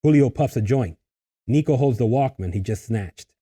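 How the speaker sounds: ordinary talk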